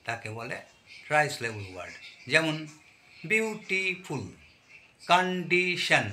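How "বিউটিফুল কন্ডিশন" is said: বিউটিফুল and কন্ডিশন broken into three syllables each; teacher chopping বিউটিফুল and কন্ডিশন into syllables. Shown as a demonstration. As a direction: sound out বিউ-টি-ফুল, কন-ডি-শন, higher than usual. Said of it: "তিনটে syllable থাকে".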